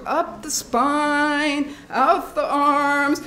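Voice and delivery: slow, drawn-out guiding; a coach talking them through it slowly; slow coaching cue. Shown as a demonstration. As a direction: lead the movement slowly. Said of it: "allow yourself to shake and shiver".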